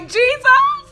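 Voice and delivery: high, loud mock-panicked wail; panicked cry. Retelling her panic as she shuts the car door, cried out. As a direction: cry out to Jesus at full voice, acting out panic.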